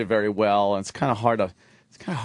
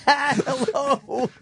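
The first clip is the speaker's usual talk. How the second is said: very high laughing "hello"; mocking mimicry. Host parrots the Russian-girl impression, high and laughing. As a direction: parrot a silly female voice through laughter.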